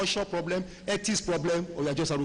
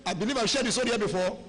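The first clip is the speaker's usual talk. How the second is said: normal talking voice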